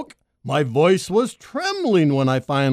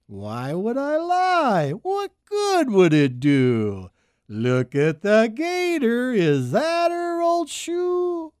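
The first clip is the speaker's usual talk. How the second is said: slow snake voice; 6 semitones higher, slower than usual. A narrator's reading. Snake answers slowly.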